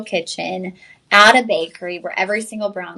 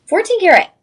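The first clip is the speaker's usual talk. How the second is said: much higher, louder burst; excited emphasis; 11 semitones higher, 7 dB louder than usual. Real, not acted. Host hyping the gold to viewers, excited burst. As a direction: punch it out with delight.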